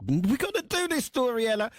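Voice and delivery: high-pitched, excited plea, retold; eager pitch. Presenter retelling urging a colleague to do the story, excited and high-pitched.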